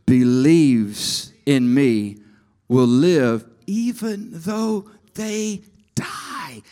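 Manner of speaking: each word stretched out and weighed; slow emphatic reading. Shown as a demonstration. Preacher slowly stressing the verse for the congregation. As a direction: Believes in me said slowly, with deep weight. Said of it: "the one who what?".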